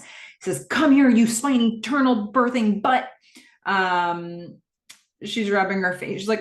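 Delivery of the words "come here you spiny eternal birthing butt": loud, higher-pitched taunt; heckling. Acted. Gordon heckling the screen as he plays, loud taunt. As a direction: hurl a raised-voice insult at the enemy. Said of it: "He's heckling the screen".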